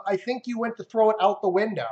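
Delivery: ordinary talk, nothing marked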